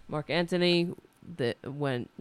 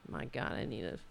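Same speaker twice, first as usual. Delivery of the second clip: ordinary talk, nothing marked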